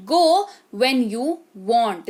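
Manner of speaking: very slow, careful teaching read; slow model sentence. Shown as a demonstration. Teacher modelling sentence slowly for the class. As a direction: say Go when you want word by word. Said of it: "teacher repeats model sentence".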